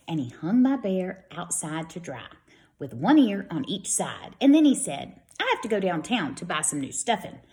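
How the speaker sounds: lively storybook read-aloud; children's story voice for young listeners. A narrator's reading. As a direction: read it brightly to a class of kids. Reads picture book to children.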